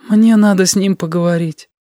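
high-pitched hopeless plea; high, despairing girl's line. A narrator's reading. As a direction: say it with no hope left, pitched high. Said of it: "безнадёжно сказала Сашка".

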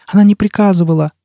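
put-on high child's voice; frightened child. A narrator's reading. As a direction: answer as a cornered little child. Child answers under pressure.

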